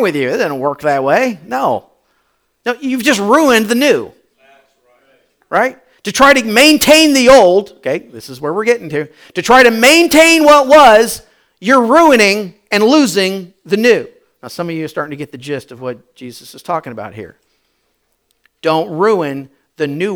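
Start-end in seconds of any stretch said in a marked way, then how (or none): 6.0-14.1 loud, driving preacher emphasis; preaching. Acted. Pastor to congregation, loud driving preaching. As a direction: hammer each phrase home to the church.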